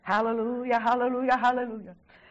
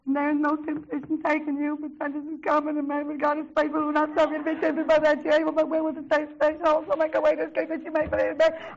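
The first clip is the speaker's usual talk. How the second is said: rushed, sing-song mock sobbing monotone; imitated tearful reading; 4 semitones higher, faster than usual. Crying pastor's wife reading the verse aloud, imitated tearful rush. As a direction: race through the verse like someone blubbering. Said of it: "I'm gonna try to read it like she did".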